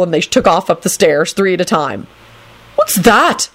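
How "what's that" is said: high, startled holler. A narrator's reading. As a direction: holler the question in alarm. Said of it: "Neville hollered".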